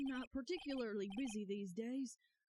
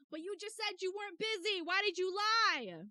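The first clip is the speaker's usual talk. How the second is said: indignant accusation; 8 semitones higher, 8 dB louder than usual. Acted. Player voicing the hero accusing Grossberg of lying, loud. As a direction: demand the truth.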